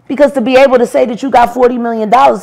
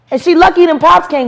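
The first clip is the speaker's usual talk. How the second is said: ordinary delivery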